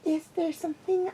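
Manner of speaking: high, light filly voice; high childlike question; young pupil's voice. A narrator's reading. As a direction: ask it like a curious little girl. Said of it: "the gremlin said".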